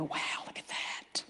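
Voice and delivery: breathless hushed whisper; hushed wonder; whispered wow. Acted. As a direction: whisper it in awe. Whispered aside about birds.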